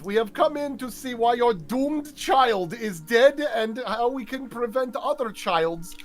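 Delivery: slow, hammed-up Russian accent; pompous Russian accent, drawn out; mock Russian accent. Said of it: "giving this mock voice a Russian accent when at best I'm trying to do a British thing".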